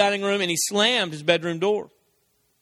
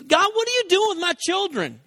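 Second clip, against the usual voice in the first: mock angry complaint; 10 semitones higher than usual. Angry believer complaining to God.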